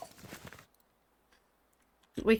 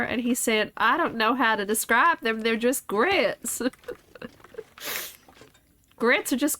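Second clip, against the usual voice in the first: put-on Southern accent; 2 semitones higher than usual. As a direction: spin the yarn in exaggerated Southern. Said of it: "put a timer on this accent".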